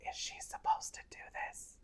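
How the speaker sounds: imitated nurse's furtive whisper; hushed, voiceless aside. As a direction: whisper it worriedly to a colleague.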